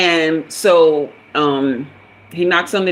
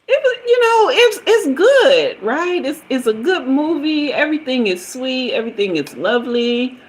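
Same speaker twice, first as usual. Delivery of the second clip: gushing high voice; 8 semitones higher than usual. Retold or imitated. Recalling the sweet movie with her date, gushing high voice. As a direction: swoon over the movie, sliding back down. Gushing about Pretty Woman.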